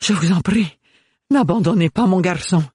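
high, pleading voice; imploring. A narrator's reading. Bérénilde begs Ophélie, imploring and high. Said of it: "un regard implorant".

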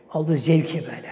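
usual speaking voice

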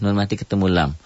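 usual speaking voice

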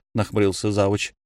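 ordinary delivery

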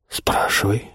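whispered. A narrator's reading. Continues whispered exchange after kiss.

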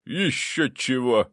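ghost's haughty refusal; scoffing. A narrator's reading. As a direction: dismiss it with a drawn-out snort. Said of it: "хмыкнул предок".

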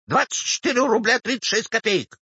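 put-on high, prompt old woman's answer; eager old woman. Old woman names her sum, eager. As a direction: reel off the exact sum in a high voice.

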